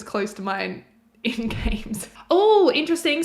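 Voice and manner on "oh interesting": sudden pleased surprise; surprised. Real, not acted. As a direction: perk up at a discovery. Noticing detail in trailer.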